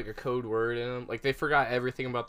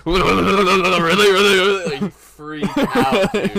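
loud laughing; 6 semitones higher, 13 dB louder, slower than usual. Real, not acted. Laughing while telling a story.

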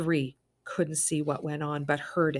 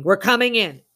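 loud threat; 5 semitones higher, 12 dB louder than usual. Acted. Intruder threatening through door.

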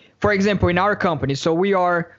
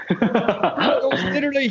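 laughing; 7 semitones higher than usual. Real, not acted. Laughing after spider scare.

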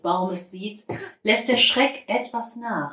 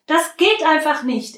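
put-on raised, insistent child's protest; stubborn retort; 8 semitones higher, 5 dB louder than usual. A narrator's reading. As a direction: dig in your heels and insist, louder. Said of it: "erwidert Käthe hartnäckig". (trotzig und deutlich lauter beharren)

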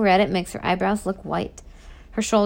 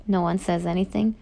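plain reading voice

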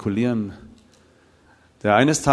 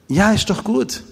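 much higher, insisting it really is good; emphatic reassurance; 10 semitones higher, 4 dB louder, slower than usual. Retold or imitated. Speaker insisting to the puzzled biker, high reassurance. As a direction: reassure him again, higher and firmer. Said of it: "Ja, wie?".

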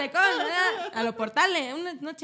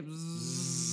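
low, slow buzzing imitation; soft low imitated buzz; wasp buzz; 8 semitones lower, 8 dB quieter than usual. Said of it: "suena de que".

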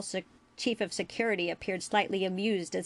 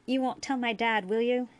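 put-on higher-pitched boyish plea; pleading; 4 semitones higher than usual. A narrator's reading. Boy begs the doctor not to tell dad, pleading. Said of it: "Jake's eyes pleaded with him".

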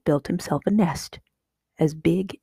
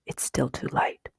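soft hushed whisper; breathy secret whisper; 3 semitones lower, 6 dB quieter than usual. A narrator's reading. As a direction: whisper so nobody hears. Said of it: "Jeremy whispered".

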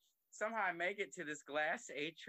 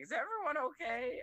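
worried mom; 12 semitones higher, 4 dB louder than usual. Retold or imitated. Mom bursting into bedroom.

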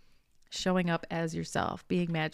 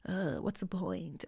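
slow, softer, acted-out reluctance; mock defeated; 6 dB quieter than usual. Voicing the dread before starting work, mock defeated. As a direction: drag it out like you can't be bothered. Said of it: "that precursor feeling of".

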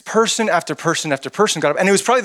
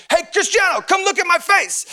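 accented mock yell. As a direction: yell it like a cheering fan, accent and all. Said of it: "I would yell at".

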